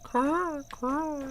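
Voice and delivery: very high squawking crow voice, drawn-out meh; silly crow. Pretend. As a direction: play a dopey crow claiming its country. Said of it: "I'm a crow".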